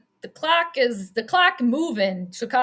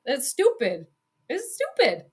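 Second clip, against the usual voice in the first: ordinary talk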